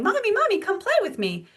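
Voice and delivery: put-on high, singsong child voice calling mommy; little boy begging. Three-year-old son begs mom to play, high singsong child voice. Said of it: "He's saying".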